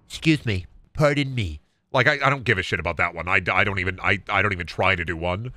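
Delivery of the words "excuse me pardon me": lightly voiced Trump impression; a hint of small-mouthed Trump. Performer half-tries Trump, small-mouthed voice. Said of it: "Trump voice?".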